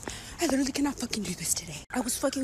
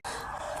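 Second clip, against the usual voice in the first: gasp; 12 dB quieter than usual. Retold or imitated. Realizing it's not candy.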